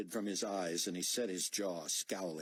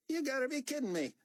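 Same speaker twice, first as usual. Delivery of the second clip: higher, louder, indignant soldier voice; defiant young corporal; 4 dB louder than usual. Acted. Corporal refusing to refuel the plane, higher and defiant. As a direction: push back hard, you're not his lackey. Corporal disbelieves Buck's threat.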